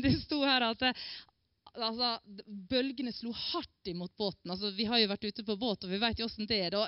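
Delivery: normal talking voice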